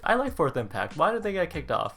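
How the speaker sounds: ordinary delivery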